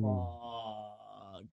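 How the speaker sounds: long, low, quiet drawl; low drawn-out curse, retold; sinking dread. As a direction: draw it out softly as dread sinks in. Dread as the car's lights go out.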